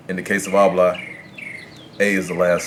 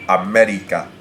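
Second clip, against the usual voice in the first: América with MÉ stressed; Spanish América, weight on the É; stress on third-to-last syllable; 3 dB louder than usual. Shown as a demonstration. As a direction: hit MÉ in América, let RICA fall away. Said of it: "stresses on the third to last syllable".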